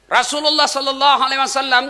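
ordinary talk, nothing marked

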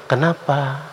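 gentle intonation. Shown as a demonstration. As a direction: ask it gently, drawing the word out. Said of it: "kata yang sama dengan intonasi yang lembut". (intonasi lembut dan menenangkan)

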